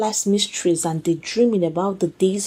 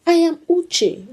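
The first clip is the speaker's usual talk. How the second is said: confident; 8 semitones higher, slower than usual. A narrator's reading. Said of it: "her voice oozing with confidence".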